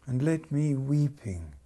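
dramatic poetry reading; poem recitation. Acted. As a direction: recite it like a love song spoken aloud. Whole piece a recited love poem.

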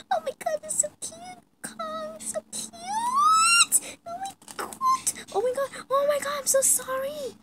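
very high, breathless squeals; fangirl squealing. Acted. The fan gushes over Logan and his pet, squealing high. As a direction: gush like a starstruck fangirl.

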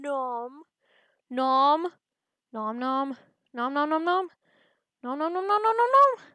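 cartoon chomping that builds to a frenzy; excited munching noises. Gobbling other cells in game.

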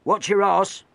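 high, loud rough warning; shouted warning. A narrator's reading. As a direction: bark a warning at him. Sailor warns crewmate.

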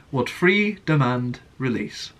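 slow, with odd gaps around "freedom and"; stilted speech, misplaced pauses before "release"; awkward emphasis. Shown as a demonstration. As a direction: break the phrase up, leaning on "and" before a separate "release". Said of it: "gaps in places where there shouldn't be gaps".

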